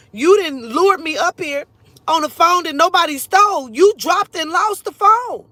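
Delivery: loud, high outraged rant; retold screaming. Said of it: "that's why I started screaming like".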